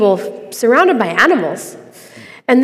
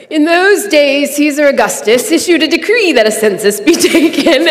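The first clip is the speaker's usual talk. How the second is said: higher, put-on Bible narrator voice; scripture narration voice; 5 semitones higher, 3 dB louder than usual. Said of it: "that's my narration voice".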